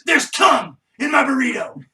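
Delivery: loud, indignant accusation; angry shout. Retold or imitated. The couch dude accusing his roommate, a loud angry shout. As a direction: demand an answer, furious. Retold outraged burrito scene.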